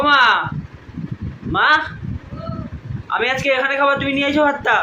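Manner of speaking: high shout to someone far away. Real, not acted. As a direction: call across the yard. Calling mother from afar.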